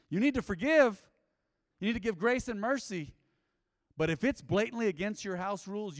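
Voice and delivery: loud, high exhortation; preacher's loud charge; fervent preaching. Real, not acted. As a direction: drive the command home to the church. Preaching at full force.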